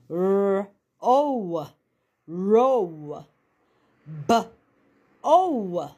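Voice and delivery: R-O sounded out, then row blended. Shown as a demonstration. Teacher models sounding out the word for pupils, slow and clear. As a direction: spell R-O, then say row smoothly. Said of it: "recap on o and ow".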